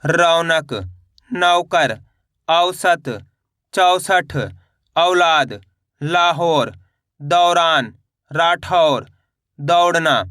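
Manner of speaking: each word read whole in one go, dropping at the end; read directly. Shown as a demonstration. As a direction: model the words for the class to repeat, one by one. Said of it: "इस तरीके से आपको direct पढ़ना है".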